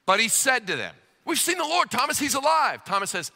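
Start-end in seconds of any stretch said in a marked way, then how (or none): 1.3-2.8 high, excited cry of good news; excited announcement. Acted. Disciples telling Thomas the Lord is alive, excited. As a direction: burst out with joyful news, pitched way up.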